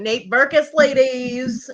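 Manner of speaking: lightly hammed-up celebrity designer, high and loud; Nate Berkus. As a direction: announce it like a showy designer. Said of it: "the whole Nate Berkus impersonation".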